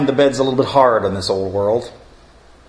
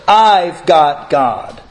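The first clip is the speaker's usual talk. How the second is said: slow emphatic declaration; 3 semitones higher, much slower than usual. Acted. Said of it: "triumphant close".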